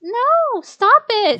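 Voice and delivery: high frantic cry to stop; desperate plea. Acted. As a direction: beg in panic. Heroine screams.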